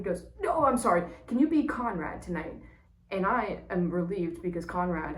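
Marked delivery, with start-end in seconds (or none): 0.4-2.5 quick correction, much higher and louder; a director on the phone correcting himself, loud and pitched up; urgent correction. Retold or imitated. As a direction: blurt out the fix like you just caught the mistake.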